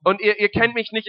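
usual speaking voice

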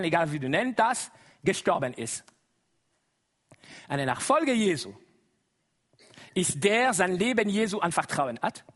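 ordinary delivery

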